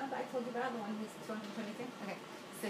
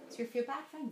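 ordinary delivery

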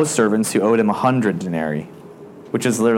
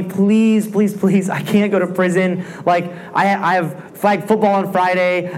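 higher, hammed-up desperate begging; mock-beg for mercy, rising higher; pleading servant; 7 semitones higher than usual. Servant begging king in parable.

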